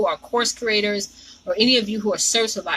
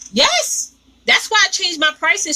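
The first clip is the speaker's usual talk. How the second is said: excited yes; 9 semitones higher than usual. Real, not acted. Agreeing with a viewer's comment.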